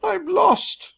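high, loud crying wail; tearful wail. A narrator's reading. Lost mouse wailing alone in the jungle. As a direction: wail it out through tears. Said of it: "I burst into tears".

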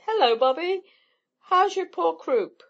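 loud, high, mock-babying greeting; high-pitched chaffing, playfully patronizing; teasing big sister. A narrator's reading. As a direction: fuss over him like a tiny child. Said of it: "treated him as though he were a very small boy".

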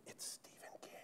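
barely breathed reveal; whisper. Retold or imitated. Narrator whispering the secret to a stranger. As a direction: whisper it so no one hears.

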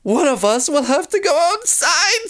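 very high, fearful storybook voice; scared. A narrator's reading. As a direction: say it dreading the dark outside. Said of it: "The Golly sisters are scared".

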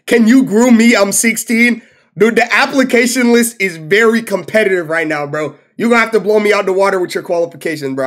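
loud joking bit; mock recruiter. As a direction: play it like a snooty recruiter.

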